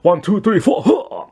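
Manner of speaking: hammed-up muscle-man count ending in a grunt; posing count.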